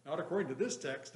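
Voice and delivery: ordinary talk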